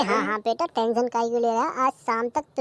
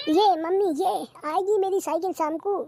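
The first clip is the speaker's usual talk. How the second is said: put-on child voice; 6 semitones higher than usual. Child voice pleading to mother.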